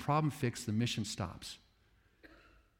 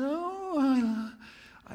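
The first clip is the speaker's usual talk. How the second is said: much higher, gushing cry; God as the crazy cat lady; doting cat lady; 12 semitones higher than usual. Retold or imitated. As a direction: coo with overflowing love.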